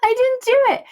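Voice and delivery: squeaky, very high admission after laughing; high amused confession. Real, not acted. Vlogger still admitting she skipped it, high and amused. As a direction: own up helplessly in a tiny high voice.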